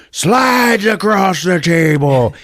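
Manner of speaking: high, loud, stretched-out exclamation; astonished shout. Retold or imitated. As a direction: draw every word out in a hammed-up yell. Acting out the shocking climax.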